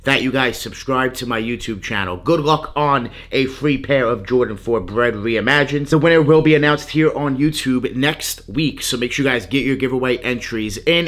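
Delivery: ordinary delivery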